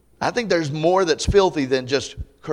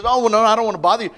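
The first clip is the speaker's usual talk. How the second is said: apologetic; 6 semitones higher, 6 dB louder, much faster than usual. Retold or imitated. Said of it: "And he says".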